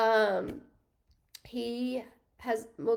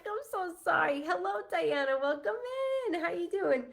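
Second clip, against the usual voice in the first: sugary greeting; 7 semitones higher than usual. Retold or imitated. Mock-sweet greeting to customer.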